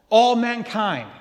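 loud, high "All mankind"; preacher driving the point home; emphatic. Real, not acted. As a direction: proclaim it to the room. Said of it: "on whom does his favor rest?".

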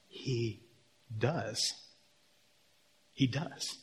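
very soft, with pauses; hushed, spaced-out answer; hushed awe. Real, not acted. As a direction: drop right down for the climax. Hushed climax of miracle story.

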